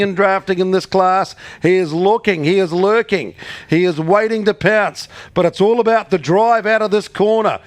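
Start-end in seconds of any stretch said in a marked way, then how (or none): 5.6-7.6 rising, building excited race call; race caller. Real, not acted. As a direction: call the photo finish, climbing to a shout. Live race finish call.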